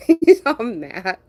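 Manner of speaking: high, loud, drawn out through laughter; giggly, stretched-out teasing; cracking up. Real, not acted. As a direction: say it while laughing hard. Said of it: "Try cracks me up".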